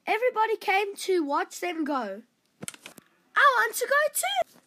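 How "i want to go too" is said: high, loud eager outburst; eager cry. Acted. Youngest son begging to go along, crying out high. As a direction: blurt it out, desperate to join. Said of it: "cried Dan the Orange Juice".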